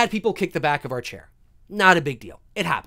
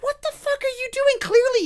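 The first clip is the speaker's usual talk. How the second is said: high-pitched retold indignation; mock outraged disbelief, pitched way up; incredulous squeal. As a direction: protest in a high, exasperated voice. Retells indignant reaction to kicker.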